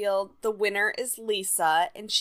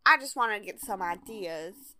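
imitating Janet; 3 semitones higher than usual. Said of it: "Janet's like".

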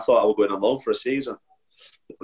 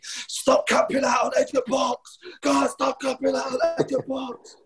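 imitating teammate screaming; 14 semitones higher, slower than usual. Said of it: "J-Bo screaming at me".

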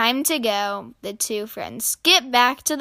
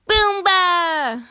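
high, drawn-out gleeful call; high-pitched game cry; playful shout; 7 semitones higher, 4 dB louder than usual. A narrator's reading. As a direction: shout it like a kid at play. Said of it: "shouted Flop".

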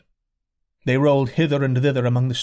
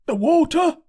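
urgent servant; 4 dB louder than usual. A narrator's reading. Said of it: "he said".

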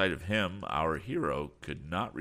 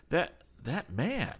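halting, higher-pitched stammer; 12 semitones higher, slower than usual. A narrator's reading. Lad shaken by seeing a rival agent, stammering. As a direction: falter slowly on the repeated first word. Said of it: "stammered the lad".

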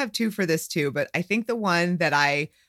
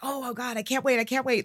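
retold excitement; 6 semitones higher, 3 dB quieter than usual. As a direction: squeal it softly, barely able to wait. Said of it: "I was totally excited for this".

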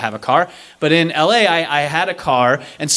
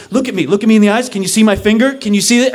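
urgent examining; 6 semitones higher, 4 dB louder, faster than usual. Retold or imitated. Stranger examining crash victim.

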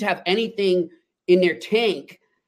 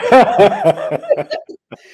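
real hearty laugh; 4 semitones higher, 9 dB louder than usual. Real, not acted. Laughs at own story.

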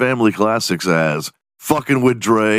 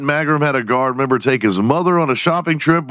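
usual speaking voice

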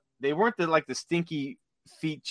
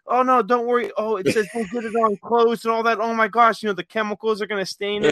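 high, teasing fake panic; mock alarm; 6 semitones higher than usual. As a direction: pretend to worry while teasing her. Teasing wife, laughing at her.

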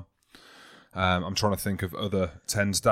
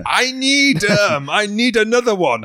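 loud, high mock French accent; put-on French accent; 11 semitones higher, 7 dB louder, slower than usual. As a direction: belt it out in a badly faked French accent, German-sounding. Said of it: "you just put on a French accent".

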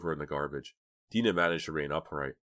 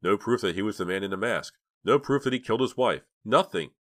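higher, louder bitter listing; 5 semitones higher, 5 dB louder than usual. A narrator's reading. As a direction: drive each point home bitterly. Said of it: "Dina interrupted bitterly".

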